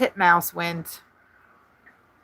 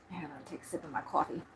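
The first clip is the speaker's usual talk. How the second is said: quick quiet aside; 3 semitones lower, 16 dB quieter, much faster than usual. Real, not acted.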